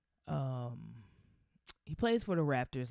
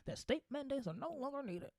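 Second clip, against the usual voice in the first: higher, pompous goofy politician; dumb politician voice; 10 semitones higher than usual. Retold or imitated. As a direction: ham up a folksy pandering governor. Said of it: "dumb, goofy, racist politician voice".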